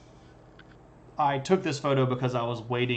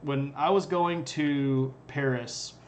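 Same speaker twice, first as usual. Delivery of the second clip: normal talking voice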